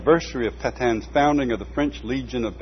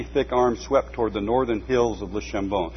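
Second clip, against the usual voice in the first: usual speaking voice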